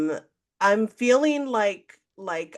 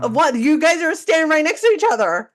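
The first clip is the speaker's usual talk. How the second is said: loud, high disbelieving challenge; taunting them in disbelief, raised voice; incredulous mock; 6 semitones higher, 6 dB louder than usual. Telling the two guys off, loud and incredulous.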